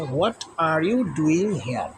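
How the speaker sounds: slow, each word spaced out; slow dictation. Shown as a demonstration. Teacher reads the unscrambled question to pupils. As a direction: read the fixed question slowly. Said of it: "এটা যদি আমরা সাজাই তাহলে এরকম হয়".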